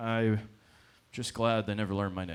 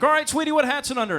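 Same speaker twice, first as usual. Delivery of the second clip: high, loud, quick announcer patter; game host; 8 dB louder, faster than usual. Retold or imitated. Stadium host asking a little girl to guess, loud quick hosting voice. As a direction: project it to a little contestant.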